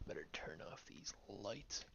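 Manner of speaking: spooky low whisper. Acted. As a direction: hush it conspiratorially. Whispered aside, dimming lights.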